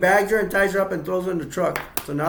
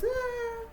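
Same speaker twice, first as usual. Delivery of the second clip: mock scream; 7 dB quieter than usual. Freak fest at the table.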